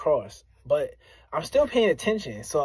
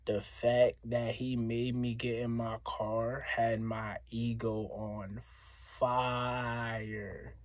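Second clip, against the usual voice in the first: much softer, slow, shaking with leftover anger; 5 semitones lower, 10 dB quieter, much slower than usual. Real, not acted. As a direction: let the voice shake as the anger comes back. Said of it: "You can hear my voice shaking".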